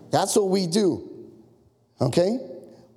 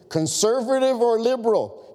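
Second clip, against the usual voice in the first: higher, eager questioning; getting excited; 7 semitones higher than usual. A narrator's reading. Narrator quizzing a fellow traveler, getting excited. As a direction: quiz them with rising excitement. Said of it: "I started to get excited".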